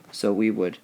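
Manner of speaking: ordinary delivery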